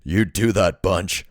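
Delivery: loud, amused, low gang leader; mock him with a rough chuckle. A narrator's reading. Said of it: "the man with the low voice said, laughing".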